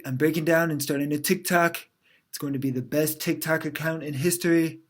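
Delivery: loud, confident self-promotion; Donald Trump, optimistic. Acted. Trump plotting to win younger voters, boastful and optimistic. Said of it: "Donald Trump must be feeling optimistic".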